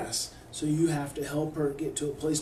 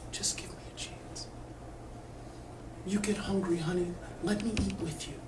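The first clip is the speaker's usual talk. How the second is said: much softer, hushed and gentle; tender whisper; 9 dB quieter than usual. Shown as a demonstration. Mother kneeling by her daughter's bed, whispering tenderly. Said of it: "So whisper".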